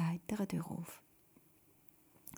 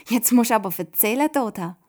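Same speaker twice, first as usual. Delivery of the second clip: loud, eager demand, pitched up; impatient; 3 semitones higher, 10 dB louder than usual. A narrator's reading. Simon pressing Toda to tell her story, impatient. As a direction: urge her on, unable to wait. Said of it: "mag der Simon nicht mehr länger warten".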